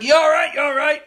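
loud, high worried dad calling out. Retold or imitated. Dad checking on his injured son, loud worried call. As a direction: call across the field like an anxious father. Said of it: "he's like".

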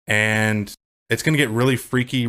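ordinary talk

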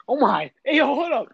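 ordinary delivery

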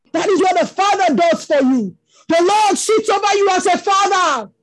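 ordinary talk, nothing marked

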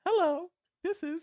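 very high, slow squeaky toy phone voice; squeaky ringtone voice. Retold or imitated. Friend mocking a toy phone's ringtone, very high squeak.

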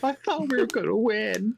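high-pitched mock crying; hammed-up sobbing disappointment, voice way up; Hillary Clinton crying. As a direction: wail it in a put-on tearful voice. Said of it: "Clinton crying".

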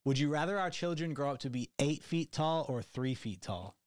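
ordinary delivery

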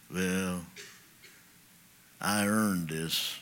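low, slow mock voice, pleased with itself; smug self-justification. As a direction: play the smug rebel who thinks he earned it. Mocking a self-justifying believer.

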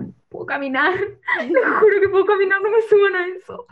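giggling please-don't-carry-me plea; laughing plea. Retold or imitated. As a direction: beg them through laughter not to lift you. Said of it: "Yo como".

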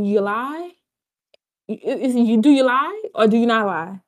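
ordinary talk

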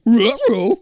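Scooby's Ruh-roh; Scooby-Doo voice. Retold or imitated. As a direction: say it like the cartoon dog. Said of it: "Scooby going".

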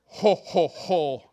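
loud mock Santa ho-ho-ho; Santa laugh. God as the real Santa, loud jolly laugh. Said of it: "the real Santa Claus".

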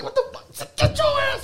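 mom's shocked voice. Retold or imitated. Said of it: "my mom was like".

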